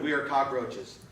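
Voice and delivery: ordinary delivery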